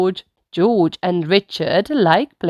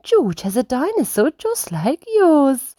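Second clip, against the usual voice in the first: higher, coaxing mother voice; gentle Mummy Rabbit; 8 semitones higher than usual. Acted. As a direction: coax her little son kindly. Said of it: "says Mummy Rabbit".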